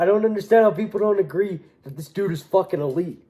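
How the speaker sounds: ordinary talk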